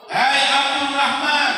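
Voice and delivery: loud, slow call of his name, stretched out; angry mother calling. Retold or imitated. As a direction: call the boy sharply across the house. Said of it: "Ketika dia marah". (ibu memanggil anaknya dengan marah, keras dan lambat)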